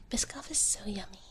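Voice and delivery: usual speaking voice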